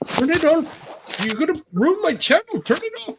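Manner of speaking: very high mock-panicked pleading; imitated panic. Guy begging for the porn to be turned off, mock-panicked. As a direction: act out a streamer frantic about losing the channel.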